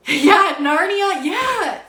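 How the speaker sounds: high, loud, tearful voice breaking; emotional tears. Real, not acted. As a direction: let the tears push the voice up high. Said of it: "Sorry, I'm, like, really emotional".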